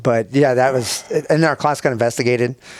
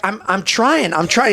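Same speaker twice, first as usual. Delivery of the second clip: flustered; 6 semitones higher, slower than usual. Retold or imitated. Buddy flustered pulling crab off.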